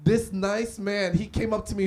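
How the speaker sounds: ordinary talk, nothing marked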